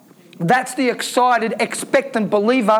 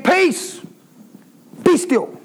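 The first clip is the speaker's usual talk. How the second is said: commanding Jesus; 6 semitones higher, slower than usual. Acted. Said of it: "He said".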